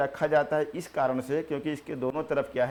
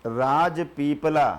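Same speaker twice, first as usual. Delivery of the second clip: slow dictation; 4 dB louder, much slower than usual. Shown as a demonstration. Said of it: "पहला हो जाएगा".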